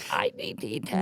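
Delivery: very low put-on voice; Sue Dillon impression. Echoing cohost's Sue Dillon lesson, low put-on voice. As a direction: drop low into the character. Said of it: "teach Chelsea how to talk like that".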